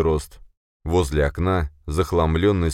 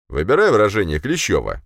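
raised voice, offended rebuke; indignant; 4 semitones higher, 4 dB louder than usual. A narrator's reading. Boss rebukes Kleshcheva for her accusation, indignant. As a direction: cut her down, louder and affronted. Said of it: "возмутился Беспалов".

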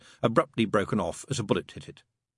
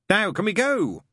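high, loud, impatient plea; exasperated; 12 semitones higher, 5 dB louder than usual. Acted. Crowley hurrying Aziraphale out, exasperated. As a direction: push to leave at once. Exasperated demon interrupts, wants to leave.

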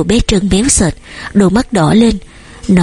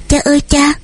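put-on child's cry; 7 semitones higher than usual. A narrator's reading. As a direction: call out for daddy in a raised child voice. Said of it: "đã kêu thét lên".

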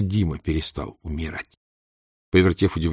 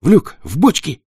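loud urgent command; commanding shout; 9 semitones higher, 7 dB louder than usual. Acted. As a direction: bark the orders. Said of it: "скомандовала Татьяна".

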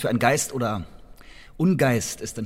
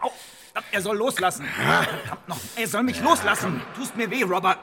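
much higher, strained protest in pain; 9 semitones higher than usual. Acted. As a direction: cry out while being held tight. Seized roughly by the guard.

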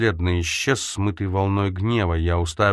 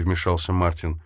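plain reading voice

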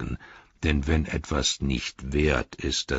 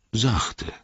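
sachte said higher with a falling tune; higher repeat; 8 semitones higher than usual. Acted. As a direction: lift the second sachte and let it fall. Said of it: "sagte Baumbart".

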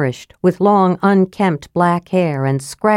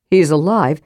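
ordinary delivery

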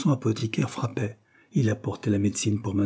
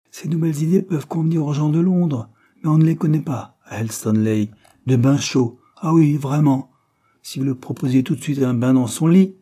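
higher, louder scoffing; ironic; 5 semitones higher, 5 dB louder than usual. A narrator's reading. Servant scorning London ideas about baths, ironic. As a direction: scoff at the newfangled idea. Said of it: "répéta-t-elle ironiquement".

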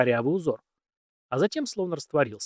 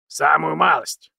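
put-on dry, slow old man voice; 3 semitones higher, 7 dB louder, slower than usual. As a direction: answer drily, like an old man. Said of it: "сухо ответил Александр".

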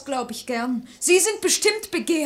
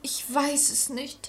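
soft, slow, hesitant; a hurt girl answering her lover, softly; quiet; 9 dB quieter than usual. Acted. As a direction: barely voice the answer.

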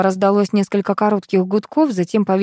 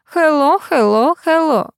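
high, lightly singsong phone greeting; two lilting rises, then a fall; hoarse soprano ringtone; 9 semitones higher, 3 dB louder, slower than usual. A narrator's reading. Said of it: "Хриплое сопрано произнесло". (певучее сопрано из трубки)

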